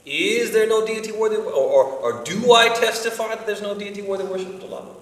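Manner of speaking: mock-incredulous high question; exaggerated question. Shown as a demonstration. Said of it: "A question".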